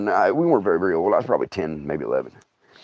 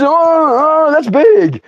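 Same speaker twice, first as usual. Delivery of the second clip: high, louder startled buddy voice; hammed-up shock at the size; mock alarmed friend; 11 semitones higher, 4 dB louder than usual. R K seeing the snake from the driveway, mock alarm.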